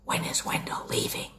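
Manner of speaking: breathy hushed whisper. A narrator's reading. Sophie whispering to her parents, fed up. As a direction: whisper it so Wendell won't hear. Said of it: "whispered Sophie".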